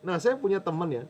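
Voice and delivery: normal talking voice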